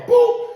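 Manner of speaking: high, sharp mock click sound; phone hang-up boop. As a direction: snap out a quick boop. Hangs up the phone.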